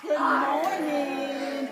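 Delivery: very slow, loud pirate call; pirate shout. Shown as a demonstration. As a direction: bellow it out slowly. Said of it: "Come on, go do it".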